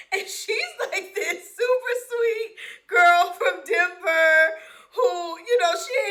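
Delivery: words squeezed through a high laugh; laughing while talking. Real, not acted. Describing her sweet friend from Denver, laughing high.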